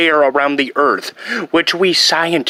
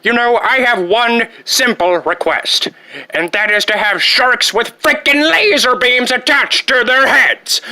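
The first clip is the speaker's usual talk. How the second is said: petulant demand; 7 semitones higher than usual. Acted. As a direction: rise into outraged entitlement.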